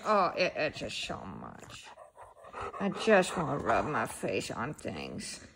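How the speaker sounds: low, soft dog voice. Pretend. Owner voicing the itchy dog, low and soft. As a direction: drop low and grumble softly for the dog.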